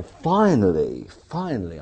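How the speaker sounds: long drawn-out, loud "finally", then easing; slow, emphatic cry of at last; exultant relief. Retold or imitated. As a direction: let out huge relief, stretching the word. Acted relief at getting through.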